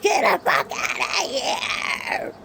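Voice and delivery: mild mock yell, slowed; a half-hearted shouted threat at the birds; retold scream. Scaring off screechy birds, mock yell. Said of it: "And I scream".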